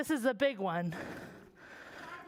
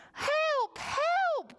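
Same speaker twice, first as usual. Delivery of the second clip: crying page. Acted. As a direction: call out in a panic, high. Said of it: "cried the page".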